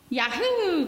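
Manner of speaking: a high, bright yahoo; little cub cheering about finding God; excited cry. A narrator's reading. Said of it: "cried little cub".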